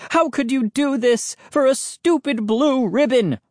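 very high, outraged accusation; shrieked. A narrator's reading. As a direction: accuse him in a high, angry cry. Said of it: "my father shrieked".

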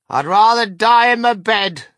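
high, loud, defiant refusal; indignant old grandma yelling; shout. A narrator's reading. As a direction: shout it in stubborn protest. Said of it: "shouted Grandma Josephine".

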